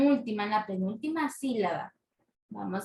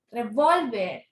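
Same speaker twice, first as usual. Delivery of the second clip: "revólver" with VÓL carrying the stress; stress on middle syllable; slower than usual. Shown as a demonstration. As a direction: lean hard on VÓL in "revólver". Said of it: "La mayor fuerza de voz cae en la penúltima". ("revólver" con la mayor fuerza en VÓL)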